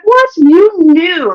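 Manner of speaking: exasperated surprise at him; incredulous. Retold or imitated. Shocked inner reaction to condom request.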